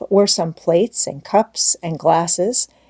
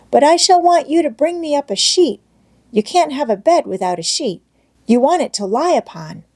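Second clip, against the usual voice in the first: put-on higher, eager child explaining; earnest little girl Heidi; 8 semitones higher than usual. A narrator's reading. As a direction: insist like a busy little girl.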